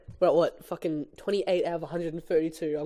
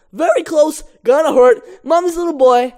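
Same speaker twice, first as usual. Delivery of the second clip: high, loud frightened cries; terrified yelps; 9 semitones higher, 11 dB louder than usual. Acted. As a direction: gasp them out in fear. Barry terrified while bouncing.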